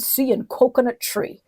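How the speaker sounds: normal talking voice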